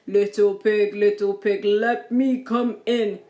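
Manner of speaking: loud, slowing wolf demand; big bad wolf. A narrator's reading. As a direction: call at the door as the wolf. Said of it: "said the wolf".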